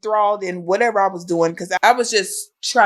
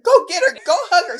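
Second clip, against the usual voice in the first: urgent urging; 10 semitones higher than usual. Retold or imitated. Telling Anna to go hug the crying mother, urgent and high. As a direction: push her to go hug the crying mom. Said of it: "I said".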